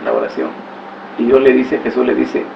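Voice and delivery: usual speaking voice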